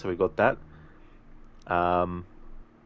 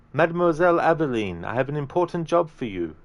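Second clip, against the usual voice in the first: put-on higher, lilting Italian accent; accidental Italian accent; 8 semitones higher, 4 dB louder than usual. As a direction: slip into an Italian accent, pitched up. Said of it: "did an Italian accent there".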